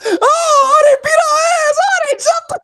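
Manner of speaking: high, loud sobbing shout; crying cousin. Retold or imitated. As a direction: cry it out in panic.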